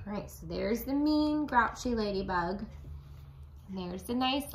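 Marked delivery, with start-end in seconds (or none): none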